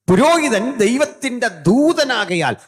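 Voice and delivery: high, loud and slow, preached at full force; thundering pulpit cry on പുരോഹിതൻ; booming proclamation. Shown as a demonstration. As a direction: hurl the line out to the back row. Preacher proclaims then restates plainly.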